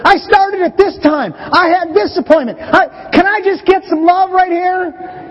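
high, slow, whiny plea for love; milk it like a hard-done-by husband; self-pitying complaint. Retold or imitated. Dad begging family for love after work, whiny and high. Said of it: "the little man".

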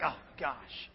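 tiny groaned gosh under the breath; whispered dread. Retold or imitated. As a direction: sigh it with dread. Dreading the crossing.

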